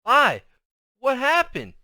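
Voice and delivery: high, loud, urgent question; urgent call. A narrator's reading. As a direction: call out to your brother in alarm. Child urgently questions brother.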